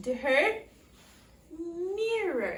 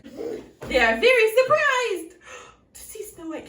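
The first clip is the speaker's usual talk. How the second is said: loud, high gasp of surprise; surprised; 6 semitones higher, 11 dB louder than usual. Shown as a demonstration. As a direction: act out a big surprise. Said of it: "can you say surprised".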